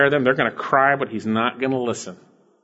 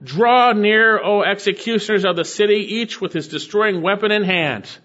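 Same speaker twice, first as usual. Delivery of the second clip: loud, high proclamation; raised, ringing command sustained to the end; crying out loudly; 7 semitones higher, 3 dB louder than usual. Acted. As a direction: cry it out like a summons to executioners. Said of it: "It's a loud, cried out loudly".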